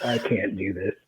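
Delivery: low, soft, near-crying mock misery; miserable groan. Hungover narrator staring at nachos, re-enacted miserable whimper. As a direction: act out being too hungover to cope.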